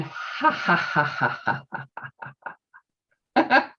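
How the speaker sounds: breathy fake laughter ha ha; laughter yoga demo; demonstrated ha ha. Shown as a demonstration. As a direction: breathe out each ha. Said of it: "let me demonstrate".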